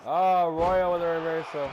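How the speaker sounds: loud sudden excited call; wrestling commentator. Acted. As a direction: jump in on the reversal.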